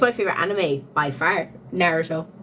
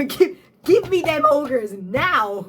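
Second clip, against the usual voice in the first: greedy demand; 5 semitones higher, 3 dB louder, slower than usual. Pretend. Voicing a greedy subscriber.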